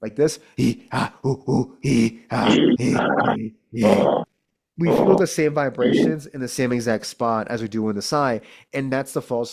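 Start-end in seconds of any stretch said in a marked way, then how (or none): 0.6-4.0 loud grunting caveman noises. Shown as a demonstration. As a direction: grunt like a caveman, chest rattling. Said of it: "make caveman sounds, like this".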